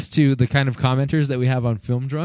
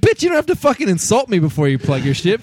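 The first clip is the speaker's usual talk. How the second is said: mock angry rant; 8 semitones higher, 3 dB louder than usual. Angry reply to bot.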